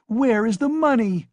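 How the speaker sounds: high, loud demand; cried out. A narrator's reading. Wife demanding the missing money, shrill cry. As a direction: shriek the question at him. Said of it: "she cried".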